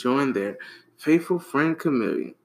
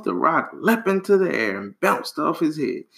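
plain reading voice

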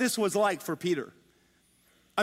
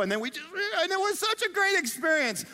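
higher-pitched mock gushing; gushing enthusiasm; 9 semitones higher than usual. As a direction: play a thrilled holiday angler. Mimicking an enthused fishing tourist.

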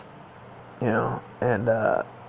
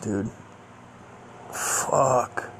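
plain reading voice

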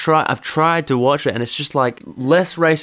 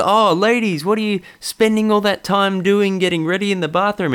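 higher, smug comic voice; mock stand-up comic; 8 semitones higher than usual. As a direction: ham up a hack joke about women. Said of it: "It's just".

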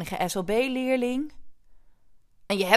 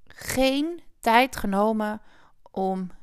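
ordinary delivery

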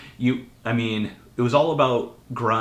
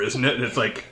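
usual speaking voice

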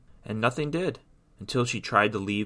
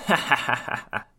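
villainess's cackle, slightly higher; mock evil queen laughter; 4 semitones higher than usual. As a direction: cackle in gloating triumph. Evil queen gloats after her threat.

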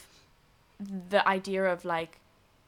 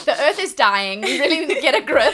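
mock dramatic; 5 semitones higher, 12 dB louder than usual. Mock dramatic loud proclamation.

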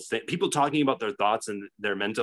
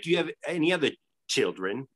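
put-on British questioning; British accent; 3 semitones higher than usual. Interviewer asking the tattooed dad, mock British accent. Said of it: "doing a British accent".

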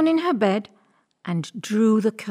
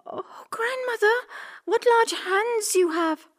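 put-on very high, astonished girl voice; gasp-like high girlish wonder; wondering little girl; 13 semitones higher than usual. A narrator's reading. Girl marvels at disguised wolf.

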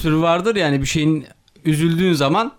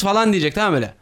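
ordinary talk

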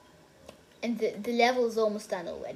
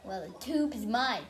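ordinary talk

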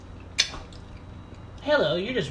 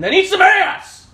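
sudden yelled punchline; screaming; 12 semitones higher, 10 dB louder, slower than usual. Pretend. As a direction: scream it out at full volume. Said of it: "Why would you just scream that?".

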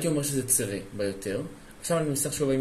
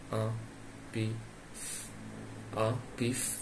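syllable by syllable; 3 semitones lower, 8 dB quieter, much slower than usual. Shown as a demonstration. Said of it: "spelling out the word".

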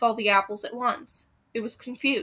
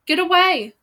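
much higher and louder bellow; 7 semitones higher, 8 dB louder than usual. A narrator's reading. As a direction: roar the troops out of danger. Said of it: "Gretel bellowed".

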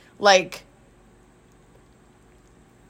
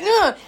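high, clipped groan; a groan cut short; mom's groan; 11 semitones higher than usual. Retold or imitated. Impersonating mom groaning in frustration. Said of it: "it's like".

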